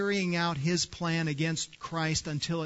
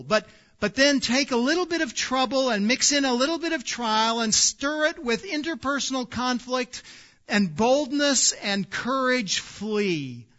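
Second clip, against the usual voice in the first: louder, higher preaching, hammering each phrase; full-force preaching; 6 semitones higher, 6 dB louder than usual. Acted. Pastor urging his congregation to take courage, loud and high preaching.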